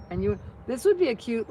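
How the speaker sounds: ordinary delivery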